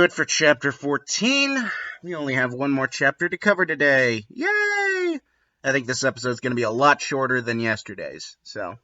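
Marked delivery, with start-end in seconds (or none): 4.3-5.2 squeaky mock cheer, much higher; sarcastic yay. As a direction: fake celebration in a sky-high squeak.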